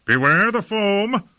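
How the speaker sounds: high, drawn-out call to troops; high-pitched battlefield warning. A narrator's reading. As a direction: call the danger out slowly across the ranks. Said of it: "called Lord Fayed".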